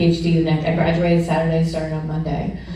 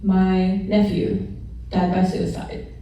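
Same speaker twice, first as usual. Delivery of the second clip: ordinary talk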